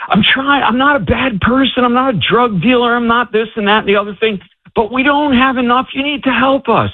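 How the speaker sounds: high-pitched pleading prayer; crying out to God. Retold or imitated. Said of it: "he's crying out, and he's saying".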